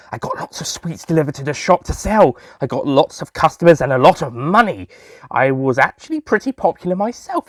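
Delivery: ordinary delivery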